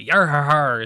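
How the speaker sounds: loud pirate-style laugh, badly imitated; Mister Krabs. Shown as a demonstration. Demonstrating the crab boss's laugh, loud mock pirate cackle. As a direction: attempt a hearty cartoon pirate laugh. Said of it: "I can't do, like, the".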